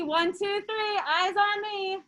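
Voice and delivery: high slow sing-song call; kindergarten teacher voice. Pretend. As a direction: chant slowly for little kids.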